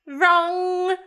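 put-on high little toddler voice; toddler's pronouncement. Toddler pointing at a guest, high childish pronouncement. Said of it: "she points at you, Faraday, and she goes".

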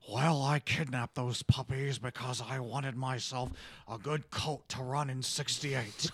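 presidential impression, speechifying self-justification; Richard Nixon. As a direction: play the scheming politician addressing the nation. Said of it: "can you quickly do your Richard Nixon".

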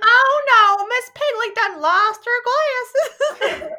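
hammed-up farmer dismay, read aloud; old farmer. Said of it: "that's a book I read to them".

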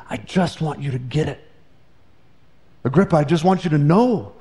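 a hushed, earnest appeal; sincere plea. Real, not acted. Pleading sincerity.

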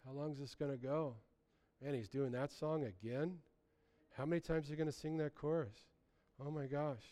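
an impatient churchgoer complaining under his breath; bored grumbling. Retold or imitated. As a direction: sound like you want the song to end. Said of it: "the other ones that are like".

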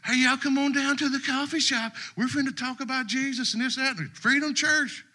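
high-pitched imitation of a video promoter; put-on high dude voice; excited dude online. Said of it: "I see this dude".

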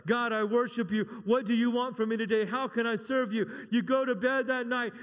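loud, high Pentecostal preaching; preaching at full force. Acted. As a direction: lift the voice and drive it home.

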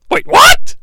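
sharp high loud double take; surprised. Real, not acted. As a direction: jump up in disbelief. Sudden pinfall on the outside.

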